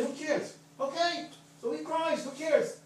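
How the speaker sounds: very high, mock who-cares protest; acted dismissiveness. Speaker weighing walking away from crying student, dismissive. Mimes the option of shrugging it off.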